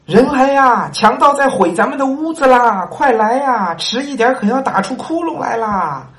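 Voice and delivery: the slave wails for help; crying out for help. Acted. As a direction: holler for help in panic. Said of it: "奴才哭嚷着".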